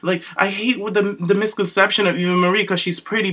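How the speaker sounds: ordinary delivery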